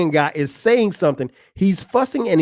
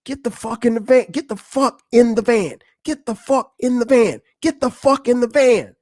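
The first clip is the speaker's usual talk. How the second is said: higher-pitched, repeated urgent command; urgent order; 6 semitones higher than usual. Retold or imitated. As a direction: act out Gary rushing everyone into the van. Retold urgent order while fleeing.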